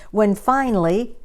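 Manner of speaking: ordinary delivery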